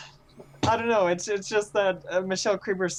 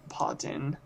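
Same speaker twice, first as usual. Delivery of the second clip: whispered, almost no voice; whispered pardon; 3 semitones lower, 7 dB quieter than usual. Real, not acted. Excusing a noise on the stream, whispered. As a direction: breathe the word out under your breath.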